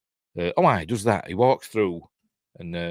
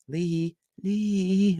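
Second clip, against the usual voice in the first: calling a name; 11 semitones higher than usual. Retold or imitated. Waking drunk Lee, calling her.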